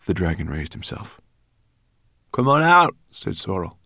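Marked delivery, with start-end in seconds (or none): 2.3-2.9 high, loud call to whoever hides; brownie calling out. Acted. Brownie girl calling into the crates, high and loud. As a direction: call them out of hiding, challenging.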